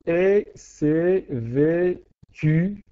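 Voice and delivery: very slow, syllable by syllable; teacher scanning the verse for the class; counting syllables aloud. Shown as a demonstration. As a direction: stretch the line out to count each syllable. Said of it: "la même chose".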